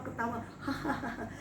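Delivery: breaks into real laughter; laughing. Retold or imitated. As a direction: let the laugh spill out. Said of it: "Saya sampai ketawa".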